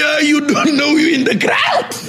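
mock-scolding shout at a rioter who doesn't know why he's there; calling out to rioter. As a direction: shout it at a young man throwing stones. Confronting a rioter in crowd.

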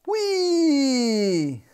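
high squeal of delight; a little girl shrieking for fun; gleeful whoop. Acted. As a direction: let out a child's happy whee. Princess whoops in glee.

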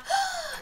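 soft, high intake of breath; delighted gasp. Real, not acted. Reacts to seeing Malia.

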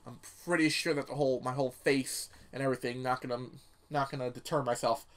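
ordinary talk

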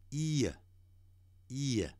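"ear" ending in a plain "uh"; no curled tongue. Shown as a demonstration. Teacher models last vowel for learners, "ear" without curled tongue. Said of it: "不卷舌的".

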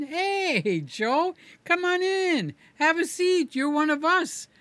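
high, friendly mock greeting; welcoming Tesla staff. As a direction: play an over-eager salesman welcoming a regular.

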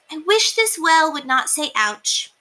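earnest small wish; mouse wishing. A narrator's reading. Mouse wishing the well won't hurt, lightly squeaky. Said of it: "she said".

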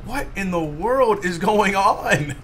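slow, high bewildered question. Real, not acted. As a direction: drag it out confused. Character broken.

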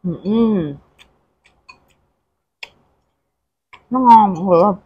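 chewing while talking; muffled speech through food; mouth full. Real, not acted. Seen 2: Food enters mouth at -1; lips closed, chewing throughout the words.

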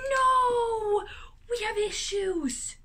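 real dismay, half crying; dismayed shout. Real, not acted. Lava lamp plug doesn't fit.

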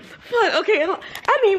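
ordinary talk, nothing marked

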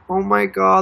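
ordinary delivery

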